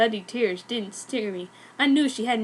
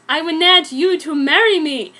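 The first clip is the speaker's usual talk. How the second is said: loud, hammed-up proposal; dramatic reading of a screamed line; mock scream; 4 semitones higher, 7 dB louder than usual. A narrator's reading. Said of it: "suddenly screamed Elise".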